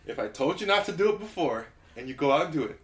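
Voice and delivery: usual speaking voice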